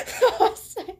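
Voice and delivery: laughing. Real, not acted. Laughing after comforting Glass.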